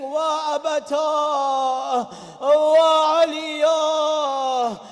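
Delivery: long drawn-out wailing lament; slow keening cry for a father; mournful wail. Acted. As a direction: stretch each word out like a mourner. Hassan laments his father.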